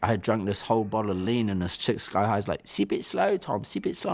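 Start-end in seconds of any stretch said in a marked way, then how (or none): 2.7-4.1 higher-pitched voice of a cautioning woman; put-on high, pleading caution; imitated warning. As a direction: mimic her urging him to go easy. Voicing the woman warning him.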